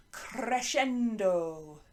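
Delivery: "Crescendo" drawn out slowly, kre-SHEN-do; slow Italian-style "Crescendo" with a long middle; demonstrating pronunciation. Shown as a demonstration. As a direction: relish every syllable of "Crescendo". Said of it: "Can you say that?".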